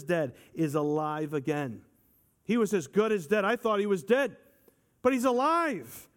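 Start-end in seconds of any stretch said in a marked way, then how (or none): 2.4-6.1 higher and louder, building joy; fervent preaching. Acted. Preacher proclaiming the father's joy, building.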